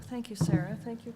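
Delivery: ordinary talk, nothing marked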